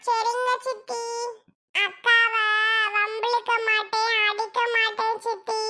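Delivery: put-on a good boy giving his word; little boy promising.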